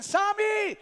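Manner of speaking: high, drawn-out shout of a nickname; loud call. Acted. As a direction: holler the boy's pet name. Calling the boy a third time.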